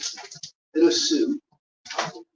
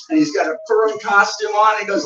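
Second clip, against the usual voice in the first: usual speaking voice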